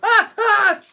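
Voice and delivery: two loud, high shrieks; sudden yelps of real fright, ending in a laugh; startled scream. Real, not acted. Card reader jolted by the cards, startled screaming. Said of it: "That scared me".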